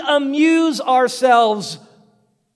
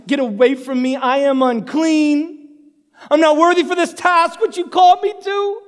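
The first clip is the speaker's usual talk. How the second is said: high, loud plea of unworthiness; anguished protest; 5 semitones higher, 4 dB louder than usual. Acted. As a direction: cry out to God, pitch climbing. Acts out unworthy convert pleading.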